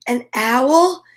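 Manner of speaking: gentle storybook reading; story narration. Acted. Parent reading Eric Carle to a child, gentle storybook voice. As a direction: read the picture book to a little one. Reads picture book aloud.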